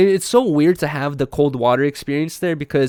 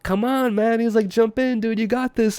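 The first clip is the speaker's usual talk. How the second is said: higher-pitched mock hype; egging on; 8 semitones higher than usual. Local dude urging Yuri off the cliff, egging on. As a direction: imitate someone urging a friend on.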